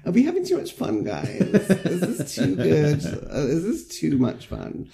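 high, slow, sarcastic whine, half laughing; mock the killjoy friend; high slow teasing parody. Mocking Sarah's worries.

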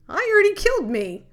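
sharp rueful outburst. Real, not acted. Bitter punchline about her overdose.

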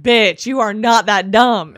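loud, slow scold; friend's scolding. Retold or imitated. Best friend scolding her on the phone, loud and slow. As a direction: Bitch drawn out loud and slow, every word weighed. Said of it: "And she's like".

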